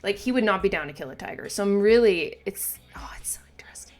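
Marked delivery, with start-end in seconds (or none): 2.5-4.0 hushed whisper to herself. Real, not acted. Murmuring to herself in awe while watching the episode. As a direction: breathe it out, barely voiced, in awe.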